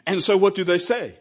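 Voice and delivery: ordinary talk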